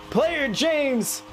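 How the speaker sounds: name yelled high in celebration; shouted name. Real, not acted.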